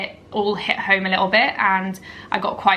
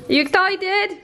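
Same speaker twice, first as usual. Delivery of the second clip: high pet-talk voice; 9 semitones higher, slower than usual. Real, not acted. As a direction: coo at the puppies in a sing-song. Cooing to her dogs.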